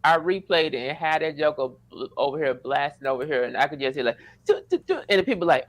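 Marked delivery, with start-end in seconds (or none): 4.5-5.0 imitated guitar plucks.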